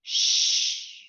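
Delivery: long unvoiced "shh" on its own; isolated sh sound. Shown as a demonstration. Teacher showing two letters making one sound, held shh. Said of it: "to make that one sound".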